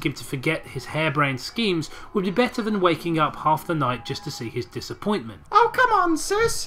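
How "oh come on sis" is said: put-on very high whining kid; hammed-up squeaky child protest; little boy crying out. A narrator's reading. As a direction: whine like a pleading little brother. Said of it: "he cried".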